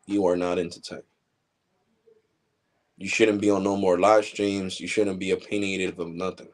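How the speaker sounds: ordinary talk, nothing marked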